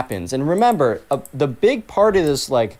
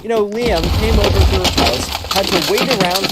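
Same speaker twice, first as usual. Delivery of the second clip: higher, louder indignant storytelling; indignant rant; 6 semitones higher, 3 dB louder than usual. Real, not acted. Teller rants to listeners, higher and louder with indignation. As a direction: get worked up about the unfairness. Teller ranting about Kenna.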